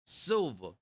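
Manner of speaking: Silva in a New York accent; lightly New York-accented Silva. Shown as a demonstration. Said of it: "like in a New York out, accent".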